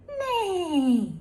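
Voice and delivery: high whinnying neigh like a horse. Pretend. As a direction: neigh like a pony. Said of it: "Can you guess what sound is this".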